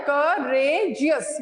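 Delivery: courageous slowly split as cou, ra, geous; teacher's syllable-by-syllable courageous; broken into syllables. Shown as a demonstration. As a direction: give each of the three sounds of courageous its own beat. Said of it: "मैंने इस तरह बोला ना".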